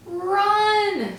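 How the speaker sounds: high, loud alarmed squeal; a shrill cry of alarm to the mouse. Acted. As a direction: shriek the warning. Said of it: "someone squealed so loudly".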